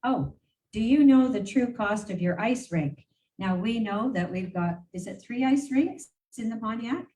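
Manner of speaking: ordinary delivery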